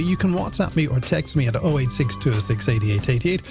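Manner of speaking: normal talking voice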